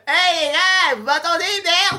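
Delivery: ruin the scene with a jarring yell; scraping character voice. Acted. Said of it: "le personnage qui scrape tout le film".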